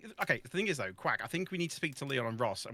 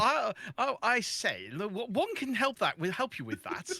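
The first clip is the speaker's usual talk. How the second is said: higher, hammed-up posh English voice; mock posh English; 4 semitones higher than usual. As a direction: parody a very proper English speaker. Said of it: "it's my posh voice".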